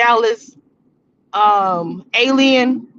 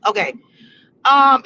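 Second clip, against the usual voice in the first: ordinary delivery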